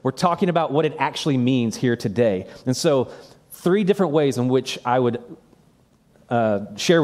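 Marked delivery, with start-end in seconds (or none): none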